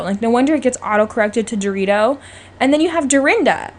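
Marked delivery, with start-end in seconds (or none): none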